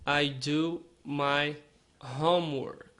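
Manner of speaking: slow, careful English model line; slow model sentence. Shown as a demonstration. Teacher modelling slowly for Brazilian learners. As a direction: say it slowly for students to copy. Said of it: "você vai falar do".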